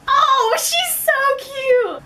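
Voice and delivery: high, drawn-out cooing; hammed-up melting "aww" voice; gushing adoration. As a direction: coo over someone adorable, stretching it out. Retold gushing over cute granny.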